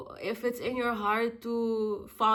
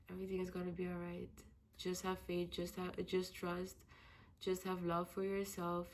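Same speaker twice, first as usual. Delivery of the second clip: soft, low comforting murmur; softer and lower, calming your own fears; quiet self-reassurance; 3 semitones lower, 9 dB quieter than usual. Real, not acted. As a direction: soothe yourself gently, almost to yourself. Soothes herself quietly.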